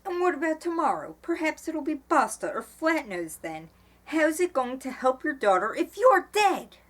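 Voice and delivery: high, loud exasperated questioning; angry challenge. A narrator's reading. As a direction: confront him, voice climbing in outrage. Eleanor angrily challenges Mo about tomorrow.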